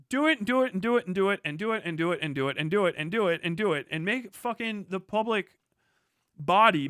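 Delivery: ordinary talk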